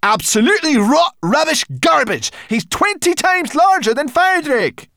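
high, loud angry outburst. Acted. Brownie girl furious at the guide, loud and high. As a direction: snap it back. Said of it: "turned on him angrily".

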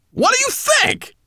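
very high, fast, loud taunting; scornful brownie. A narrator's reading. Strange brownie mocks the dragon, high and fast. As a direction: sneer at them in a squeaky rush.

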